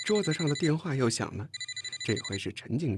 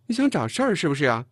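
loud, higher confrontational challenge; angry; 5 semitones higher, 6 dB louder than usual. A narrator's reading. Chen Jing challenging him in a row, angry. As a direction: spoil for a quarrel. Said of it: "陈静生气了".

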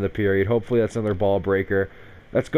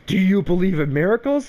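mock announcer excitement; 9 semitones higher, 3 dB louder than usual. Quoting famous hockey call, excited.

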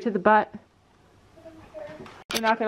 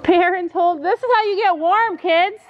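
calling out; 8 semitones higher than usual. Real, not acted. Calling out at the barn.

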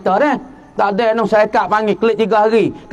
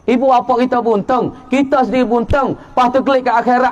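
usual speaking voice

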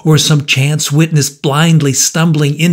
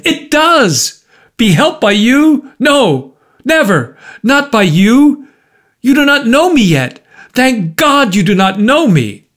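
high-pitched, vehement refusal; cried out; 9 semitones higher, 3 dB louder than usual. A narrator's reading. Markheim refusing the visitor's help, crying out. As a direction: cry out in agitation, voice pitched well up. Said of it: "cried Markham".

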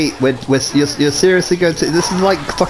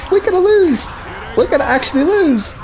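high-pitched panic; 10 semitones higher, faster than usual. Real, not acted. Losing the game.

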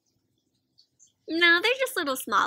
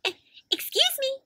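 much higher, tiny mouse voice; little mouse voice; 12 semitones higher, 4 dB quieter than usual. Acted. A little mouse interrupting the fight, in a tiny high voice. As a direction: squeak it politely like a tiny mouse.

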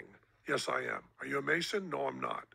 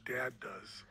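soft whispered reply; whispering; 7 dB quieter than usual. Retold or imitated. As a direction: whisper it smugly. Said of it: "he says".

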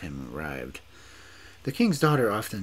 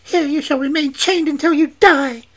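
much higher, much louder angry sentence; angry witch; 15 dB louder than usual. A narrator's reading. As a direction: pronounce it in fury. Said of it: "said in an angry voice".

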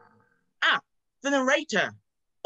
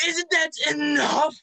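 higher, louder, slow shout; 6 semitones higher, 4 dB louder than usual. Acted. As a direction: yell up at the unseen narrator. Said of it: "Stop shouting!".

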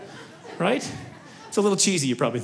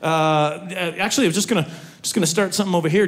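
ordinary talk

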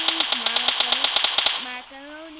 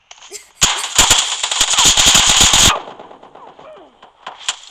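loud laugh; 6 semitones higher, 11 dB louder than usual. Real, not acted. Loud laugh after losing kill.

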